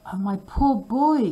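high, loud alarmed woman's voice; frightened cry. Acted. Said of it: "cried the woman".

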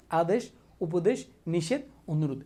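slow, spaced-out list for the class to repeat; slow drill recitation. Shown as a demonstration. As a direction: drill each word so they repeat it. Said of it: "আবার বল আমার সাথে". (ধীরে ধীরে মুখস্থ করানোর সুরে)

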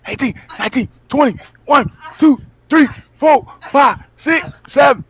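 counting push-ups. Real, not acted. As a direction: count reps like a hype coach. Counting reps during push-ups.

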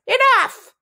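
high, loud outburst; exasperated, high-pitched yell; cried out. A narrator's reading. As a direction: cut them off with a high cry. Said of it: "Antorel cried".

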